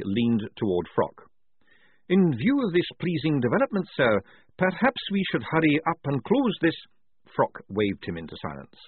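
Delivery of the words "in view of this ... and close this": higher voiced general, pitched well up; a fussy, raised officer's voice; Kazuppi, officer. A narrator's reading. General Kazuppi urges Frock to hurry, higher voiced.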